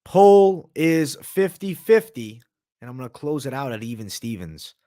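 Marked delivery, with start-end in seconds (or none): 0.0-2.4 slow, loud, higher announcer call; drawn-out announcer. Acted. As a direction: stretch it like a ring announcer. Stream host announcing poll.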